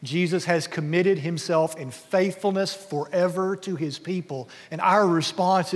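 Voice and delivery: usual speaking voice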